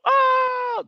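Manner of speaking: high, loud imitated cheer; whooping "ah" like guys leaping up; crowd going crazy. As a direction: mimic hyped spectators with a big yell. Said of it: "the guys jumping up".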